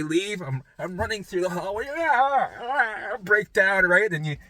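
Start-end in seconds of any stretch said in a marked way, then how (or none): 1.9-3.2 imitated panicked cries. Breakdown in hallway.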